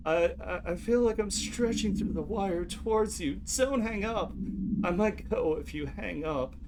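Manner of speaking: high, slow, lost woman's voice; a scared caller drifting away; frightened caller. Acted. Caller in transcript read aloud.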